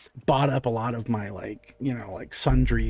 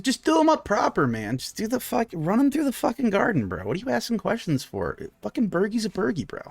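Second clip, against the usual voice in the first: higher, pushy bro voice; impatient dude brushing off questions, higher; brash bro; 7 semitones higher than usual. Acted. Felix tells halfling just make the burgers, brash and higher. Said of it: "he's like".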